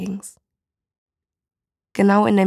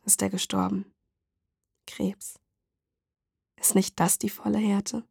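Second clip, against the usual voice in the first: subdued, quietly bereft woman; lightly voiced grieving mother; 3 dB quieter than usual. Woman tells stranger of her dead son, quietly sorrowful. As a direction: speak softly, with understated grief.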